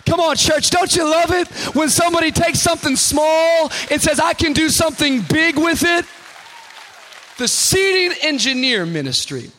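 loud, high, rousing sermon voice; full-force preaching. Acted. Pastor rousing his church, preaching at full force. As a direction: fire up the congregation.

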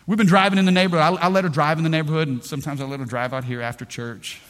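ordinary talk

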